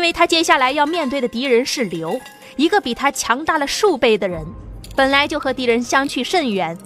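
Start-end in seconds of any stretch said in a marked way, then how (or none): none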